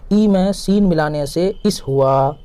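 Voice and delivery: "ای میں سین ملانے سے اس ہوا" read slowly, word by word. Shown as a demonstration. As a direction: read the rule slowly and clearly. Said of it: "تو اس ہو گیا". (آہستہ اور صاف پڑھنا)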